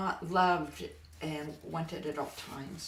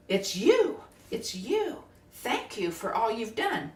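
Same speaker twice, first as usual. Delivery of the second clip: louder, higher, bursting with recognition; overflowing gratitude; 4 semitones higher, 6 dB louder, slower than usual. Retold or imitated. Greeting a long-gone ancestor, loud joyful recognition. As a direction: blurt it out in joyful wonder, higher.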